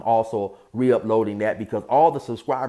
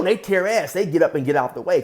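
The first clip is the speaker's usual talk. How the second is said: plain reading voice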